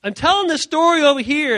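high, loud, animated; worked-up storytelling. Acted. Preacher recalls telling the story, worked up. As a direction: burst in with excitement. Re-enacting his excited storytelling.

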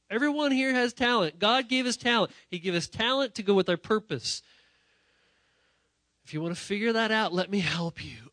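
loud, high, insistent preaching; full-force preaching. Acted. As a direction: preach it like a promise.

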